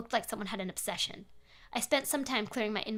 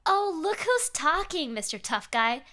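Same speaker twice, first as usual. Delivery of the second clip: mocking; 6 semitones higher, 5 dB louder, slower than usual. Stalker's mocking email reply.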